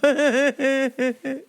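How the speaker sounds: short awkward chuckle; uneasy little laugh; nervous laugh. Real, not acted. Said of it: "that's my uncomfortable laugh".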